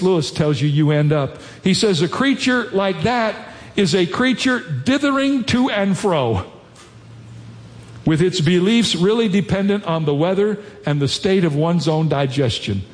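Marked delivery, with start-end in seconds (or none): 2.0-12.8 quotation reading. Acted. Pastor reading C S Lewis to the congregation, measured. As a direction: read the Lewis passage with weight.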